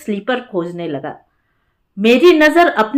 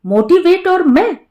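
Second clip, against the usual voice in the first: higher, disbelieving question; incredulous; 7 semitones higher than usual. A narrator's reading. Man answering a friend's request to motivate students, incredulous. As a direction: voice leaps up in amused disbelief.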